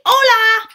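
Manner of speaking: "Hola" said loud and clear as OH-lah; Spanish hello. Shown as a demonstration. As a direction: model "Hola" slowly for the kids to repeat. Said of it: "You say".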